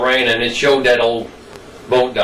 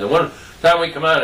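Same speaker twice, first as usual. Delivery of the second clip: plain reading voice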